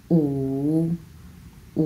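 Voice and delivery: "উ" with a dipping wave; wavy third tone. Shown as a demonstration. Teacher showing students the wave, third tone. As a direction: make the voice wave through "উ". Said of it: "উচ্চারণের সময় ঢেউ খেলাতে হবে".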